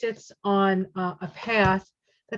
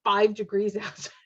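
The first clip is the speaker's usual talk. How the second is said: normal talking voice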